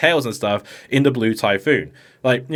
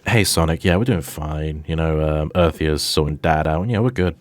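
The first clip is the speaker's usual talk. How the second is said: lower, laid-back and easy; casual Cosmo; 8 semitones lower than usual. Retold or imitated. Cosmo telling Sonic all is fine, lazy and casual. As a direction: shrug it all off lazily.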